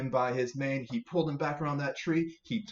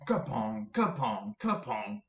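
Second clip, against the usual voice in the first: rhythmic rope-tying sound; rope sound effect; slower than usual. Acted. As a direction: tap out each kapong. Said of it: "it sounded a little bit like this".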